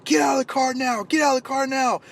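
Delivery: angry shout. Retold or imitated. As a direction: scream it at him like a road-rager. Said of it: "starts screaming at him".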